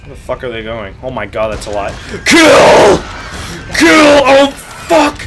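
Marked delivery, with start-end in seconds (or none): 2.2-5.3 high loud yell of kill; shout. Real, not acted. Gamer screaming at his pet to attack, loud shout. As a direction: bark the order at full volume.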